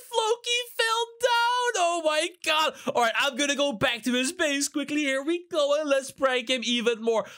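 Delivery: ordinary delivery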